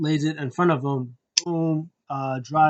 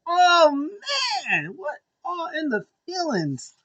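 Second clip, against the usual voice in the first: high-pitched fan outburst; overwhelmed excitement; 14 semitones higher than usual. Real, not acted. Reviewer overcome by the story, excited high wail. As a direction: let the feeling burst out, way up high.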